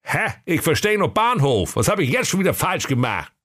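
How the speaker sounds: high-pitched grumbling sleeper-car conductor; comic high fool griping; high sulky conductor. Acted. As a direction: whine about the job in a high silly voice. Schwerthelm voice.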